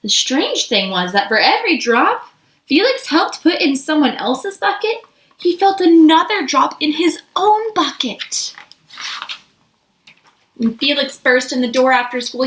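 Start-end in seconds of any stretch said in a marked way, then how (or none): none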